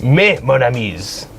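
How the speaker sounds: slow, lightly put-on French; French accent. Repeating himself for confused friends, slow mock French accent. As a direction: say it slowly as a comic Frenchman. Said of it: "In a French accent?".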